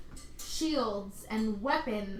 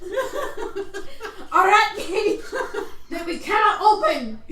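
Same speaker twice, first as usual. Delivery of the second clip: laughing shout; 8 semitones higher, 7 dB louder, much slower than usual. Acted. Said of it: "I can't do this accent".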